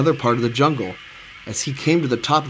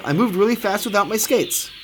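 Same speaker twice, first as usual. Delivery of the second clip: higher-pitched delighted boast; triumphant; 6 semitones higher than usual. A narrator's reading. Ketchup realizes he needs no skates, triumphant. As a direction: boast happily, voice lifted. Ketchup celebrates his success.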